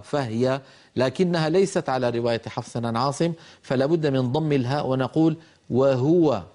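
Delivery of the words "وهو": وهو said wa-huwa, ha clearly with u; ha with damma. Shown as a demonstration. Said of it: "هكذا".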